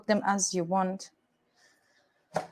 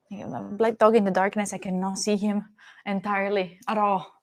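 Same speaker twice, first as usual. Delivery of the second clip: ordinary talk, nothing marked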